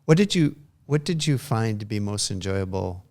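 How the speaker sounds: ordinary talk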